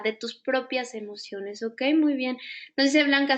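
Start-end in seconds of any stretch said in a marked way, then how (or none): none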